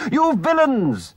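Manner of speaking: furious high shout at the villains. Acted. Rat shouting at the motorists who wrecked the cart, furious. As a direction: yell it with fists shaking. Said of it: "he shouted".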